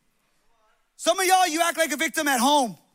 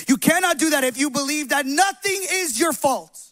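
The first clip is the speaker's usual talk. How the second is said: louder, driving sermon delivery; preaching at full force; 5 dB louder than usual. Real, not acted. Pastor urging the congregation out of victimhood, forceful preaching. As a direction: preach it hard to the room.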